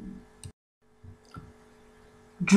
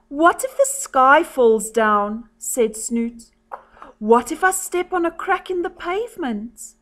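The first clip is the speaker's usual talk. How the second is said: softer storybook voice, lifting for worried lines; soft narration with higher worried quotes; story reading to children; 3 semitones higher, 5 dB quieter than usual. A narrator's reading. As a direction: read a picture book softly to children. Reads a picture story aloud.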